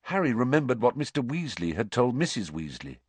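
usual speaking voice

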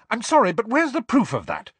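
high, loud impatient demand; snapped. A narrator's reading. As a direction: cut her off sharply. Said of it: "snapped Hermione".

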